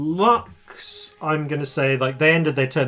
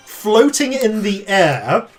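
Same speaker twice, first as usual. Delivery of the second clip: ordinary talk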